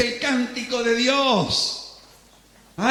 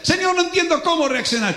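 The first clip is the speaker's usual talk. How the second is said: higher, quick anxious plea; confused believer; 6 semitones higher, 3 dB louder, faster than usual. Acted. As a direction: fret aloud to the Lord. Believer pleads to God.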